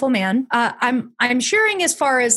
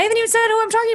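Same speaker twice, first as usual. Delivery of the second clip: rushed self-introduction; 11 semitones higher, much faster than usual. Real, not acted. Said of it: "I'm nervous".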